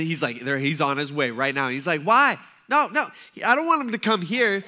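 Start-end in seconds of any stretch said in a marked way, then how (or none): 2.0-4.6 higher, louder alarmed protest; alarmed refusal. Retold or imitated. Centurion refusing to let Jesus come to his house, alarmed and louder. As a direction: push the visit away in a panic.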